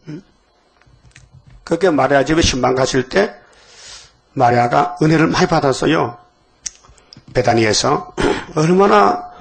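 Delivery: ordinary delivery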